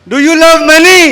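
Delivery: high, very loud rallying shout; shouted crowd call demanding an answer; rousing shout. Real, not acted. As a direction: belt out the question to fire up the whole room. Rousing a crowd that lacked energy.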